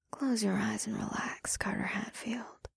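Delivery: seductive whisper. Acted. Succubus hypnotic command.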